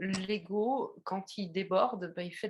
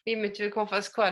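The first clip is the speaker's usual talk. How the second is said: ordinary talk, nothing marked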